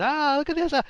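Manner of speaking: big swooping pitch, hammed-up cheer; exaggerated happy inflection. Shown as a demonstration. Showing how to add inflection like a slapstick comedy, exaggerated happy voice. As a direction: make big goofy noises, climbing very high at the end. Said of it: "put more inflection in your voice and talk happy".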